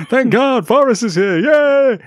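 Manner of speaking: high, loud cheesy crowd cheer; hammed-up cheer. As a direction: overdo a dubbed crowd's joy. Mocking overdubbed crowd lines.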